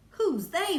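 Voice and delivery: high, loud alarmed cry. Acted. Showler alarmed.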